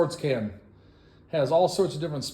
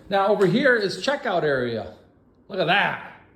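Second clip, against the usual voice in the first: loud, raised-pitch enthusiasm; excited; 5 semitones higher, 3 dB louder than usual. Real, not acted. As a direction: announce it with excitement. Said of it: "Excited about that".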